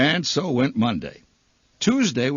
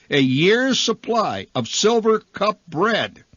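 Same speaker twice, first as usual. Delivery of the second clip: YEARS pushed high and loud, drawn out; slow, loud build-up on "years supply of Silver Cup bread"; grand-prize announcement; 9 semitones higher, 5 dB louder, slower than usual. A narrator's reading.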